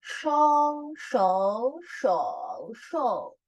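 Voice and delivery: shou through the four tones; four Mandarin tones. Shown as a demonstration. Teacher drilling tones on shou. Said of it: "Zui hou yi ge, terakhir".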